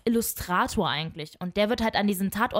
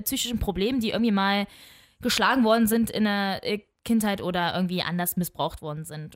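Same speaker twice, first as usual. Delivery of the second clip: ordinary delivery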